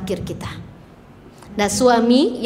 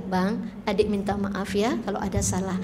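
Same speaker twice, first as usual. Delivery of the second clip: soft apology; 5 semitones lower, 8 dB quieter than usual. Shown as a demonstration. Wife's short bedtime apology demo.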